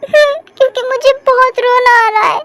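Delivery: high, weepy confession; crying. Acted. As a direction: say it like tears won't stop. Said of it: "पर क्यों रोने का मन कर रहा है". (रोते-रोते ऊँची आवाज़ में)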